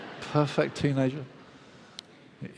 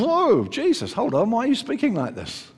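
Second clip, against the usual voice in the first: high-pitched startled protest; taken aback; 7 semitones higher than usual. Acted. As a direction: pull Jesus up short, pitched high. Acts out shock at Jesus' words.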